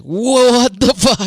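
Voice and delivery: loud, high, slow shocked outburst; shocked exclamation. Pretend. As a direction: stretch out the disbelief. Reacting to the gunshot scene.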